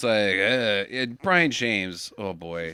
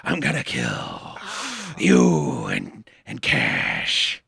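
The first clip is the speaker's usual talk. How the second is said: menacing villain; 8 semitones lower than usual. Retold or imitated. Mocking Jack Palance's lurking villain voice for friends.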